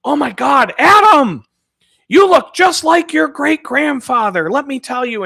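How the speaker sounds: put-on high, loud, slowed old lady gushing; high loud elderly lady exclaiming; great-grandmother's voice. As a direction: gush like a delighted old grandma. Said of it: "the first thing that she would say".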